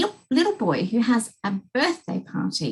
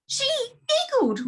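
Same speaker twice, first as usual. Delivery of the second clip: much higher, tearful complaint; king's high wail. Acted. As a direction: whine it out on the verge of tears. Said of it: "went on the king".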